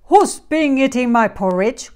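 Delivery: loud, gruff growl of a great big bear. A narrator's reading. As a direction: growl it loudly as Papa Bear. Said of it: "growled the great big bear in his deep voice".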